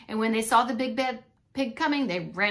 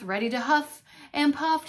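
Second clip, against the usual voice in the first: usual speaking voice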